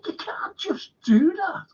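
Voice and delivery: very high, whispered, whimpering plea; mock Mexicans. Mocking the Mexicans over the Gulf renaming, high whispered whimper. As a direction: whimper it high and breathless like a hurt child. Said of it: "the Mexicans said".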